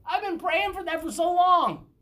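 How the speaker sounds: a believer recalling the moment it clicked; sudden realization. Retold or imitated. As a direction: relive the moment it finally clicked. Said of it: "And I was like".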